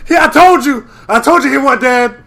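high, loud told-you-so; gloating self-imitation. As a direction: replay your own smug boast. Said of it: "legitimately said that".

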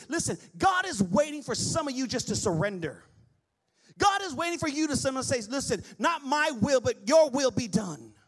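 rising, loud sermon build; climbing pulpit cry; preaching at full force. Acted. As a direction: preach it out to the room.

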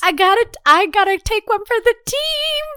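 high, strained mock-heroic wail; melodramatic martyr. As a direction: announce a sacrifice with overblown anguish. Continues the hammed-up self-sacrifice.

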